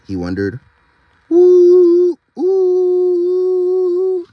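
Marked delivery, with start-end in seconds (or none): 1.3-4.3 high, loud spooky ooh; ghostly wail. Acted. The ghost light calls across the rain, eerie wail.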